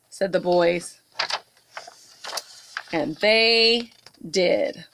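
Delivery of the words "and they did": ordinary delivery, nothing marked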